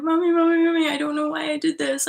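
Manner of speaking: put-on high, wailing child-like plea; tearful pleading. Calling her mom in tears, high wailing plea. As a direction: cry out to mom like a scared kid. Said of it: "burst into tears".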